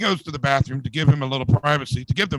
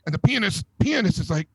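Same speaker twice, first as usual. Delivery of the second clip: normal talking voice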